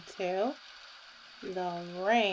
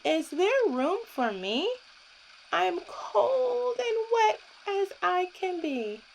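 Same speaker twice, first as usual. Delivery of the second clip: higher, whiny cold pig; lightly voiced shivering pig; 8 semitones higher than usual. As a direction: complain like a soaked little pig. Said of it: "said Pig".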